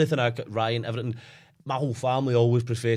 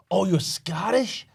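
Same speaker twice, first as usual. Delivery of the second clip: slow, higher-pitched American caricature; mock American accent; 6 semitones higher, slower than usual. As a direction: drawl it like an excited American tourist. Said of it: "in America or met with Americans".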